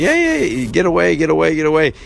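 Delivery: high, urgent warning calls; mock yell to drive someone back; imitated guards yelling. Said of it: "security guys were yelling".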